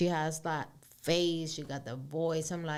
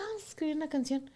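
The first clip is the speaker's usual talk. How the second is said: fast, higher, eager agreement; eager reply; 8 semitones higher, 4 dB quieter, much faster than usual. Retold or imitated. Agreeing with sister over drinks, quick eager echo. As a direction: jump at the idea, quick and bright. She echoes sister's idea.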